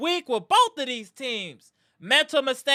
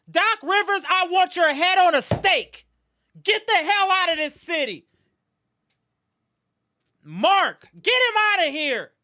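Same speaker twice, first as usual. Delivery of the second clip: higher, hot-headed fan yelling at his teams; angry rant; 6 semitones higher than usual. Real, not acted. Packers fan raging at his losing teams, furious. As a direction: vent the fury at the losses. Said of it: "I'm, I'm angry, y'all".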